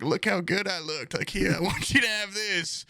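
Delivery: put-on high, sweet old-lady voice; grandma voice. Grandson imitates grandma asking about her painting, high and sweet. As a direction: mimic a frail grandma showing off. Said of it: "She's like".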